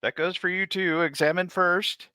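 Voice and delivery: loud, high commanding voice; high stern call. Acted. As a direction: bark the order. Character ordering companions.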